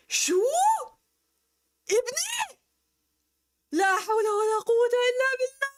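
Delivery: high, fast Syrian Arabic father; shocked Syrian dad. Pretend. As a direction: gasp it out like a scandalized Syrian dad. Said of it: "But, like, in a Syrian accent".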